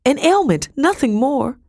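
high, pleading protest; high-pitched cry of protest; desperate cry. A narrator's reading. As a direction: insist in a high, urgent voice. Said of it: "I cried".

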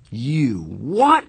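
high loud disbelieving shout. A narrator's reading. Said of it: "He was shouting".

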